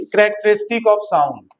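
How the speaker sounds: the heading drawn out, very slow; slow dictation. Shown as a demonstration. Teacher dictating a new heading to students, very slow. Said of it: "तो next heading लिखिए".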